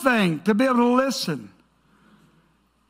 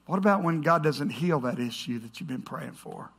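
much lower and softer; tender, searching; 7 semitones lower, 7 dB quieter than usual. Real, not acted. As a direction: gently ask the hurting listener. Softens for painful questions.

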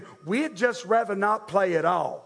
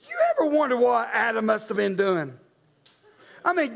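usual speaking voice

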